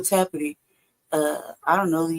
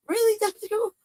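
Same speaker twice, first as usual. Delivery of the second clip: high incredulous squeal; imitating her own shocked reaction; squeaky stunned disbelief; mock disbelief; 10 semitones higher than usual. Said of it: "I looked at it like".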